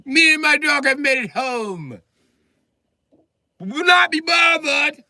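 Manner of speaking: high, loud, haughty proclamation; pompous little lord. Acted. Midget barricaded in his house, lording it over the cops. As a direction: declare it like a tiny king in his castle. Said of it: "like I'm Peter Dinklage".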